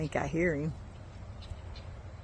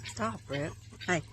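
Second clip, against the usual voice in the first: normal talking voice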